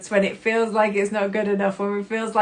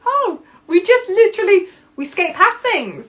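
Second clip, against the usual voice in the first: realisation; 11 semitones higher, 6 dB louder than usual. Retold or imitated. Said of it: "I was like".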